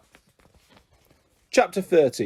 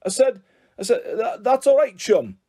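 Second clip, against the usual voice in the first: stumbling, pitched up and a little louder; flustered stammer; 7 semitones higher, 3 dB louder than usual. A narrator's reading. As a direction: caught out, stammering to cover it. Teacher fumbling, flustered stammer.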